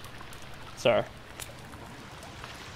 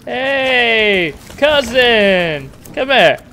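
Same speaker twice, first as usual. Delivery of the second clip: loud, taunting call; 6 semitones higher, 11 dB louder, much slower than usual. Real, not acted. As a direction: shout to lure it over. Calling out to a monster.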